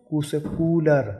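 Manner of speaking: कूलर with a drawn-out uu; long uu. Shown as a demonstration. Teacher shows long uu in कूलर. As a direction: hold the uu in कूलर longer. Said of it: "दुगना समय के लिए होगा".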